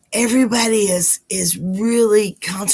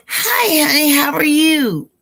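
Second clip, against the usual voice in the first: much higher, louder sugary hello; high sweet greeting; 9 semitones higher, 4 dB louder than usual. Real, not acted. Greeting a regular viewer in the chat, high and sweet. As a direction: chirp a bright hello to a favourite.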